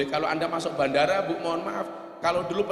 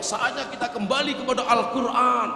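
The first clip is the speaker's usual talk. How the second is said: higher-pitched mock rallying cry; raised-pitch, mildly shouted slogan; imitated shouting; 6 semitones higher than usual. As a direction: mimic zealots crying out a slogan. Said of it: "teriak-teriak seperti itu".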